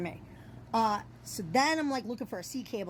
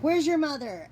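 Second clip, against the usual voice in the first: raised, higher mock yell; retold yelling; 7 semitones higher, 5 dB louder than usual. Storyteller replaying her scolding of a fawn, mock yell. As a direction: call it out like scolding a stray animal. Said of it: "me yelling at the deer".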